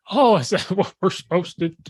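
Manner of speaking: high-pitched fake surprise; mock sarcastic. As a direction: answer a heckler with mock discovery. Sarcastic answer to heckling.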